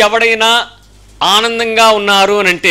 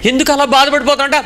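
ordinary talk

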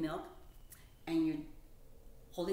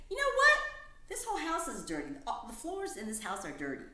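shocked discovery; 8 semitones higher, 4 dB louder than usual. Acted. Mouse complains about dirty house.

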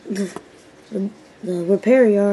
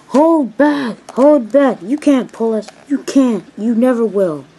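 loud, jeering truck voice; mocking, loud chant of troublemakers; taunting trucks; 3 semitones higher, 8 dB louder than usual. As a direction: shout defiance at the engine. Trucks taunt and defy Percy.

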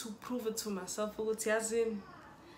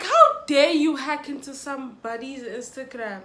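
mock outrage; 3 semitones higher, 8 dB louder than usual. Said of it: "And I was like".

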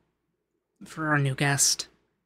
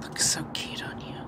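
whispered aside; under-breath slip; 6 dB quieter than usual. Acted. Rich host whispering a flustered compliment.

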